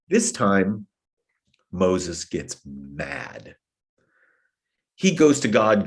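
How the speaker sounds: ordinary delivery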